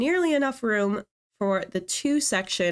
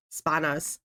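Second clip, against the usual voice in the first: a quick, half-hearted stab at a British accent on "Spanos", landing nearer Irish; attempted British accent; 5 semitones lower, 3 dB quieter than usual. Shown as a demonstration. Vlogger trying the team name in a British accent that comes out Irish. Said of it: "should I say it with a British accent?".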